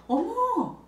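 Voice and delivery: high, loud, put-on amazement; pretend surprise. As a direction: act amazed at how far the child got. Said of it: "놀래주는 척을 해요". (놀란 척 감탄하는 말투)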